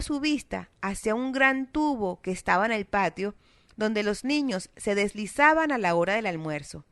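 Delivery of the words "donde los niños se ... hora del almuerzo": plain reading voice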